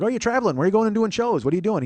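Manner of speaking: high-pitched eager questioning; imitating friendly curiosity. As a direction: mimic a chummy clerk firing off friendly questions. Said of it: "He's just a really friendly, nice man".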